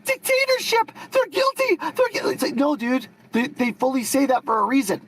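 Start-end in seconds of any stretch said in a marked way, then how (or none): 0.0-2.3 high, whiny mock of the other guy's outrage; squeaky mock accusation, dripping with ridicule; mocking imitation. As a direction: parrot him in a shrill, silly voice. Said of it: "you have this, like, big, like".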